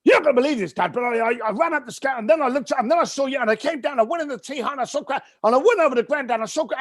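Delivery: high, fast tumbling words; excited rush. Retold or imitated. Eager son rushing news to his dad, high and fast. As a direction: blurt it all out in one breath.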